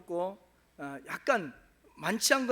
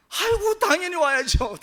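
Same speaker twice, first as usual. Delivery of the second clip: much higher, louder, fawning yes; eager agreement; 9 semitones higher, 10 dB louder than usual. Retold or imitated. Husband eagerly agreeing to go to church, high and loud. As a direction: gush it like a man relieved to be spared.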